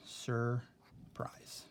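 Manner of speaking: "surprise" as sur, long pause, prise; very slow "surprise" in two separate parts; broken into syllables. Shown as a demonstration. Teacher showing the long I's syllable, slowly split. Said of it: "all of my syllables".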